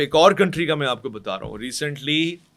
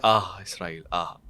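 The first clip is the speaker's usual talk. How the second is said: low, soft sigh of admiration; acted hushed acclaim; muted, sighing support; 8 semitones lower, 6 dB quieter, slower than usual. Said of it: "करने का अंदाज और है".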